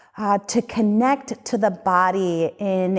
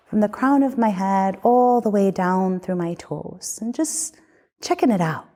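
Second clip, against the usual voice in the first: guided meditation. Acted. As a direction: lead them gently through the body. Leads a guided body scan.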